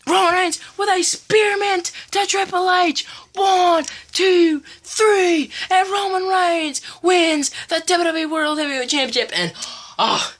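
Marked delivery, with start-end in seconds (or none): 0.1-9.3 high, fast ringside calling; excited play-by-play. Pretend. Kid retelling the match, calling it like live commentary. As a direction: call the finish like a hyped commentator.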